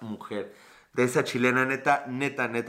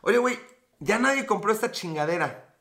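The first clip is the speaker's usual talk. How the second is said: a lightly voiced, higher fed-up shopkeeper; imitating an American shop owner; 6 semitones higher than usual. Shop owner griping to his partner about unsold stock, mock exasperated. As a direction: play the annoyed store owner, a bit higher. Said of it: "lo que hacen es de".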